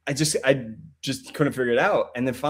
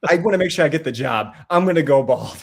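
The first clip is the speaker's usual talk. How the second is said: eager resolve; 7 semitones higher than usual. Retold or imitated. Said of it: "And I was like".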